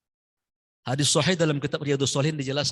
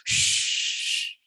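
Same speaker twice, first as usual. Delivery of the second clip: wind effect hiss. Retold or imitated. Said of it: "Ayatnya cuman efek angin".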